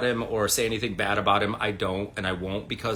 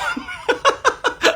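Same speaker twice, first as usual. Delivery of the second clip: loud high laugh; 7 dB louder than usual. Real, not acted. Laughing at the friend's old joke, a big high laugh.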